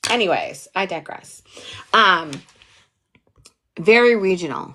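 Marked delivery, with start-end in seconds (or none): none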